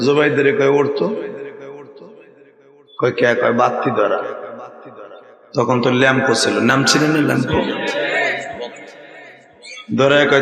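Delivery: hushed storytelling. Acted. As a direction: draw them in quietly. Soft storytelling of night scene. (নিচু নরম গলায় গল্প বলা)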